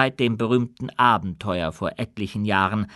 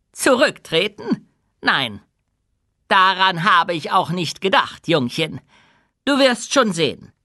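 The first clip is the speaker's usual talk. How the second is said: high, raised voice, brushing off the suggestion; amused king; 6 semitones higher, 5 dB louder than usual. A narrator's reading. King answers his friend's talk of resigning, amused. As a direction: scoff it out loud and confidently.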